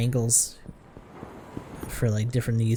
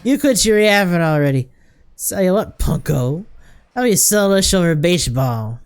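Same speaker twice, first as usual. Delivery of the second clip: higher, louder slurred challenge; belligerent drunk; 5 semitones higher, 4 dB louder than usual. Acted. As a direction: cut them off like a cranky drunk. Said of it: "especially seeing how drunk you are".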